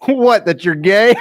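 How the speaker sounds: usual speaking voice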